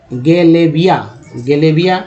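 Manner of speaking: ordinary talk, nothing marked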